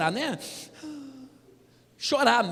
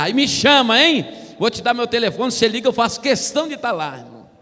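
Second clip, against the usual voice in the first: loud, pushy church brother inviting everyone; loud preacher invitation; 2 semitones higher, 7 dB louder than usual. Retold or imitated. Church brother loudly inviting the congregation, pushy. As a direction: boom it out like an insistent church brother. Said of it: "fecha aspas".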